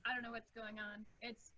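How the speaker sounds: faint voice, too far off; quiet, distant laptop mic. Real, not acted. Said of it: "Sounds like you're far away".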